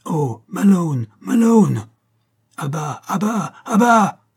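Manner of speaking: high, drawn-out shout building in force; crying out. A narrator's reading. Man under attack by a dog, crying out to Malone. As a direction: call out urgently, rising to a shout. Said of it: "cria la voix".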